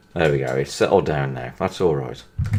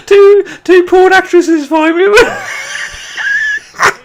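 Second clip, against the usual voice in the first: high, loud, slow laugh-crying; laughing so hard it hurts; crying with laughter over the message; 13 semitones higher, 5 dB louder than usual. Real, not acted. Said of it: "I'm crying here".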